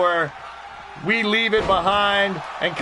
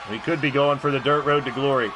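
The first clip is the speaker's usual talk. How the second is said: ordinary delivery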